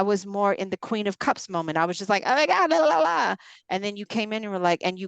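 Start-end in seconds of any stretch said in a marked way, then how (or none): 2.2-3.3 high, loud sing-song "la, la, la"; mock dramatic wail. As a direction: ham up a swooning meltdown. Dramatic Queen of Cups moment.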